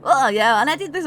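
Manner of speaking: put-on high, loud childish boast; eager kid talking big; bragging kids. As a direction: show off to dad. Kids brag to their father.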